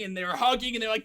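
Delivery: ordinary delivery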